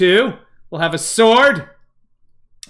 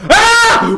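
high, loud yelp; sudden shriek of fright; startled scream; 5 dB louder than usual. Real, not acted. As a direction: scream out in alarm. Startled again by creature.

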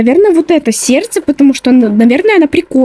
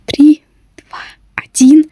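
whispering; 2 semitones higher, slower than usual. Real, not acted. Counting down in whisper.

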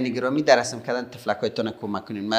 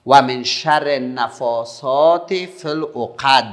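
loud, slow, drawn-out recitation; measured, projected Quran teaching voice; recitation model; 3 semitones higher, 8 dB louder, much slower than usual. Shown as a demonstration. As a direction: call out the verse slowly for pupils to repeat. Models verse for pupils to repeat.

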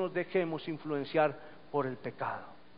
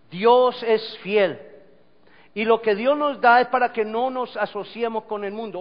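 loud, raised, emphatic preaching; predicación a plena voz; 5 semitones higher, 9 dB louder than usual. Real, not acted. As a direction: exhort the congregation forcefully. Preaches at full force. (predicar con fuerza, en voz alta)

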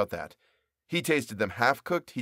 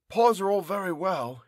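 higher, character voice of a weary cook; baker's voice; 7 semitones higher than usual. Acted. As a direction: voice the young baker, a bit put out.

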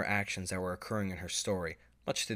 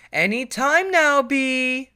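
much higher, much louder slow call across the house; 12 dB louder, much slower than usual. Acted. Mother calling her daughter to dinner, loud drawn-out call. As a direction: call her from the other room. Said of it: "called her mother".

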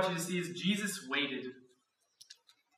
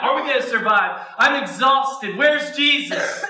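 louder, frantic disciples in the storm; panicked disciples; 6 semitones higher, 7 dB louder than usual. Acted. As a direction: cry it out like you're sure you'll drown. Disciples in chaos voiced.

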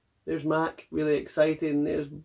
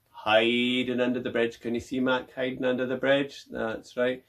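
plain reading voice

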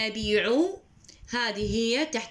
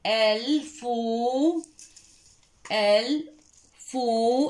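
stretched syllable; 2 semitones higher, much slower than usual. Shown as a demonstration. Teacher to young pupil, stretching الفو very slowly. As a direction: draw out الفو very slowly, rising at the end. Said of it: "teacher stretches syllable for pupils".

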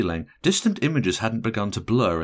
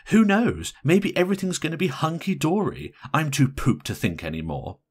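higher, animated gossipy telling; matron of honour; 9 semitones higher, 3 dB louder than usual. A narrator's reading. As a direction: tell the news in a raised, pointed voice.

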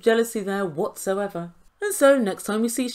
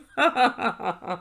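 short laugh. Real, not acted. Laughing at Bilal.

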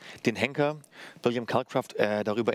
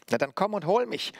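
high-pitched puppet jeer; Punch, mocking challenge; 10 semitones higher than usual. A narrator's reading. Puppet dares the hangman to attack, mocking challenge. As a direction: jeer and dare him in a raised voice. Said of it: "höhnte Punch herausfordernd".

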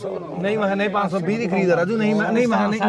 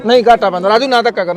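loud protest; 3 semitones higher, 9 dB louder than usual. Real, not acted. Protesting the forced deal.

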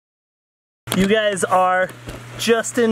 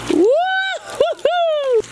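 high, drawn-out joyful whoop; excited whoop; much slower than usual. Real, not acted. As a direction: whoop it out as you slide. Sliding down a soapy slope.

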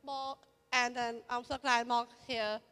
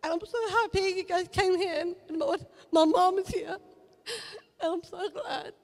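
higher, louder voice breaking with tears; emotional, near tears; 5 semitones higher, 6 dB louder than usual. Real, not acted. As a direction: fight back tears while thanking your mom. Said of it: "I am so emotional".

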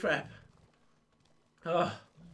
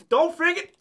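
usual speaking voice

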